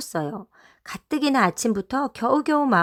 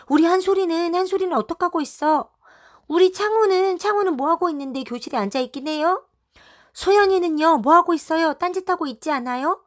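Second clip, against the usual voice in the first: much higher, louder pleading; anxious eager questions; 8 semitones higher, 4 dB louder than usual. A narrator's reading. Mothers swarming the lookout, high and urgent. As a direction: pepper her with worried questions.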